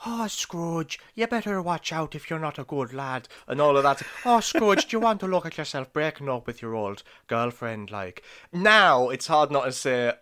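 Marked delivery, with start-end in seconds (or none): 0.1-3.3 put-on high, soft Irish lilt of a candle boy. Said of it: "in his little Irish voice". 4.2-7.9 put-on Irish boy voice. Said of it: "in his little Irish voice".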